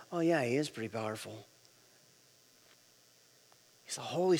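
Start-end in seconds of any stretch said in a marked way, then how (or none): 0.1-1.5 low, soft, underwhelmed murmur; offhand realization. Acted. Preacher mimicking a casual Sunday singer, offhand. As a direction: toss it off quietly, barely impressed. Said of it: "sing a song and go".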